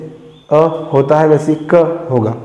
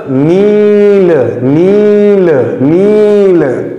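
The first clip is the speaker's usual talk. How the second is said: Kali said very slowly, long आ and ई; teacher modelling the word slowly; slow drawn-out reading; much slower than usual. Shown as a demonstration. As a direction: stretch Kali out syllable by syllable. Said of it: "आप बिल्कुल सही पढ़ रहे हैं".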